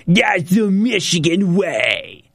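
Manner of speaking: mocking put-on caricature, unlike your own voice. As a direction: imitate her hammed-up slogan delivery. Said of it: "doing this caricature thing".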